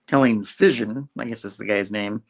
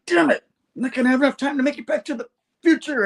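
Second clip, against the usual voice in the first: much higher, fast frantic outburst; 4 dB louder, faster than usual. Retold or imitated. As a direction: rush it out in mock panic. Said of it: "Hector's like".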